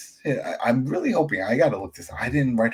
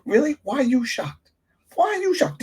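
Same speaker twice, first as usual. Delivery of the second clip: exasperated disbelief. Real, not acted. Mocking her shocked reaction.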